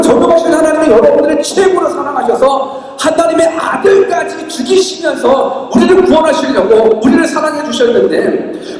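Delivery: ordinary talk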